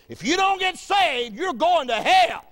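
high, slow, drawn-out proclamation; preached dire warning. Retold or imitated. Old man delivering the Holy Ghost's warning to a sinner, preached dire warning. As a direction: stretch each phrase out and pitch it high.

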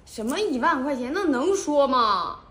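scolding. Acted. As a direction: snap back in disbelief. Scolding his blunt answer.

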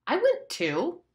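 high, loud retort; indignant. Acted. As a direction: fire it back. Ralph defends himself loudly.

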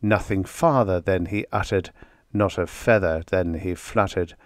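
plain reading voice

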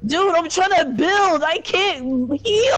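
high, wailing gamer complaint; real high-pitched frustrated protest; distressed whining yell. Real, not acted. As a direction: cry out helplessly, stretching the last words. Losing fight in game.

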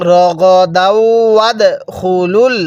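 drawn out tajweed chant; slow recitation. Shown as a demonstration. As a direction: stretch রগদাউ ওয়াদখুলুল slowly. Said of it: "তাহলে কিভাবে পড়তে হবে?".

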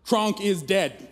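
slow, deadpan news cracking into a laugh; laughing blunt announcement. Retold or imitated. Dad bluntly telling his daughter the cat died, laughing. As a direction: deliver it flat, then crack up.

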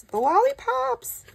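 high, slow, thrilled squeal; freaking out. Real, not acted. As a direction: squeal it in delight. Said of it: "I'm freaking out".

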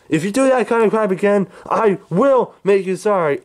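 furious. Acted. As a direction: lay into him in rage.

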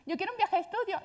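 súplica muy aguda y pesada; adolescente suplicando. Retold or imitated. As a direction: insiste como una cría caprichosa. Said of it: "pero bueno". (hija insistiendo a su madre, muy aguda)

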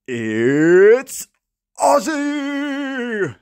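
big rising hype call with the name held high and long; hammed-up arena reveal; ring announcer intro. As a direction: sing out the name like a fight announcer. Wrestling-style entrance announcement.

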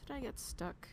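very soft, hushed dismay; small, breath-only worry; worried whisper. Real, not acted. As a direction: whisper it as you realise you're stuck. Stuck in the game, worried.

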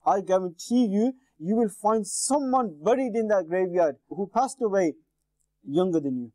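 usual speaking voice

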